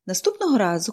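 ordinary delivery, nothing marked

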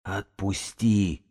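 very slow, strangled wheeze; drawn-out plea through a squeezed throat; hoarse croak. A narrator's reading. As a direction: croak it out as if being choked. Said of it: "просипел бегун".

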